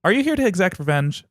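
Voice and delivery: high, keen sister voice; eager Electra. Acted. Sister urging her brother toward revenge, high and eager. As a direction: press him eagerly about revenge.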